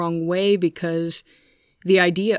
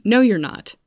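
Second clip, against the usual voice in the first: higher, louder mock challenge; imitated blunt contradiction; 4 semitones higher, 6 dB louder than usual. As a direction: mimic a friend calling the bluff, louder. Friend flatly contradicts the answer.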